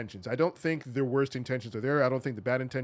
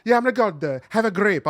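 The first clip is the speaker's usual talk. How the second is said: bad Italian accent; 5 semitones higher, 5 dB louder than usual. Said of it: "the accent he's doing sounds like very bad Italian".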